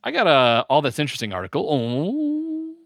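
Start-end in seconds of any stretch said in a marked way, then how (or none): 1.7-2.7 high, sustained "ooh" noise; new noise. Shown as a demonstration. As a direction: hold one high, steady tone. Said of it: "that's my new ... noise".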